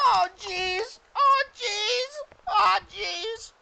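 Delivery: very high, loud goblin whine; goblin chorus. Acted. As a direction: squeal it like a fretting goblin. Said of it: "All the goblins kinda look at you and they go".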